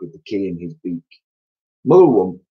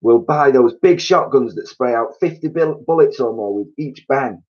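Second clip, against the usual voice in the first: high, loud eager scheming; gleeful; 6 semitones higher, 4 dB louder than usual. A narrator's reading. Mrs Twit gloating about shotguns. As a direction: relish the big guns.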